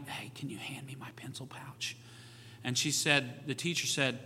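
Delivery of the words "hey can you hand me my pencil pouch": put-on daughter whispering to the girl beside her; hushed classroom whisper, no voice. Daughter asks classmate for her pouch, whispering. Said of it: "said something very quietly".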